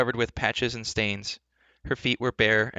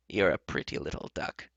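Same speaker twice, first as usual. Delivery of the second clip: low, coaxing enchantress; kind, cajoling; 6 semitones lower, 4 dB quieter than usual. Acted. Enchantress flattering the heroine, kind and cajoling. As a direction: wheedle kindly in a lowered voice. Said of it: "in a kind, cudgeling voice".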